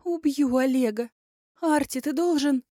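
high, loud frantic pleading; frantic, near hysterical. Acted. Elya's desperate ranting.

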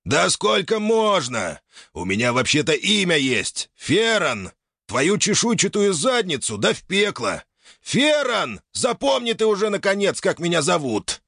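put-on high, exasperated old man ranting; indignant old man. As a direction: rant at her, fed up. Old man yells at dragoness.

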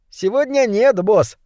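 high little rat voice; assuring. A narrator's reading. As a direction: assure the boss in a high-pitched voice. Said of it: "заверил Сардины". (заверяет тонким крысиным голоском)

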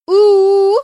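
loud, high dragon howl; answering howl. A narrator's reading. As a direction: howl back loudly, high and drawn out. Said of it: "раздалось в ответ". (громкий высокий вой в ответ)

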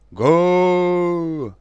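loud ragged crowd roar; war cry shout. Acted. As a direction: bellow approval like soldiers. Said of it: "нестройно, но одобрительно грянули".

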